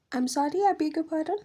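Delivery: high, incredulous pushback; indignant disbelief. Real, not acted. Woman recounting the meeting, high indignant disbelief. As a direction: scoff at the accusation, pitch jumping up. Indignant at remark about emotion.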